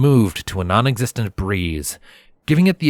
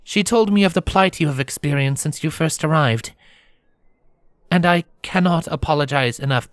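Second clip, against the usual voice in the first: solemn, formal princess, pitched higher; 7 semitones higher than usual. Acted. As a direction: apologize gravely in a princess's voice. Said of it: "the princess said more solemnly".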